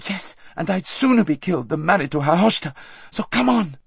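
high, urgent retort; fierce insistence. A narrator's reading. Aravis forcing her friend to move, fiercely. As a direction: drive her on, out of patience.

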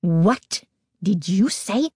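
slow, deliberate indignant demand; slow, outraged question; growing outrage. A narrator's reading. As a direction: space the words out in tight outrage. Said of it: "her voice tight with growing outrage".

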